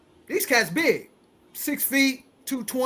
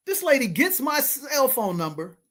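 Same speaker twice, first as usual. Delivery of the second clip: ordinary talk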